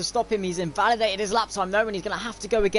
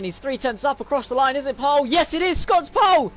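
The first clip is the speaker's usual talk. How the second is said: building to a high, loud climax; excited race commentary; 7 semitones higher, 6 dB louder than usual. Real, not acted. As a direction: call the pole lap, rising to a real shout. Live commentary of a pole lap.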